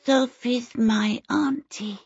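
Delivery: put-on high, slow little-boy lisp; sweet lisping child. A narrator's reading. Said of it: "answered in a sweet, lisping voice".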